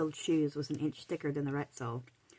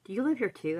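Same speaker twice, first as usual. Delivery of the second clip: higher-pitched surprised question; taken aback; 6 semitones higher, 3 dB louder than usual. A narrator's reading. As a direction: ask as if caught off guard. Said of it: "rather taken aback".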